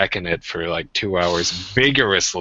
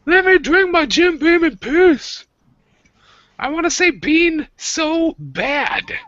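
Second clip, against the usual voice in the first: shrieking at a much higher pitch, loud; whiny high shriek; 5 dB louder, slower than usual. Pretend. Son shrieking at mom to leave his Jim Beam alone, loud and high. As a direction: throw a teen tantrum at mom. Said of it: "And I'll be like".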